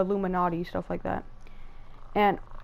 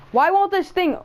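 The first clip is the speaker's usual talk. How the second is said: much higher and louder, annoyed at the game; frustrated outburst; 10 semitones higher, 8 dB louder than usual. Real, not acted. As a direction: blurt it out at the stuck game. Game not working, frustrated.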